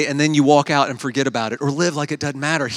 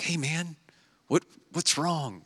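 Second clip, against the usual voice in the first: hushed whisper; 11 dB quieter, slower than usual. Retold or imitated. Checking on sick friend quietly.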